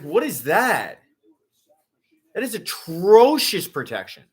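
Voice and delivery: high-pitched, incredulous fan complaint; stretched-out, exasperated outburst; exasperated disbelief. Real, not acted. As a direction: wail in dismay at terrible play. Dismayed at the same play.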